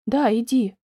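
ordinary delivery

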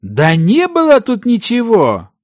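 high-pitched exasperated outburst; angry exclamation. A narrator's reading. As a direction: cry out crossly at your partner. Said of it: "гневно выкрикнул его партнёр". (гневный выкрик)

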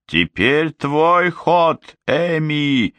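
high, slow, stretched-out cry; shouted call. A narrator's reading. Witch hands the move to Amy, high call. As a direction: call across the fray, pitch held high. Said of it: "крикнула колдунья".

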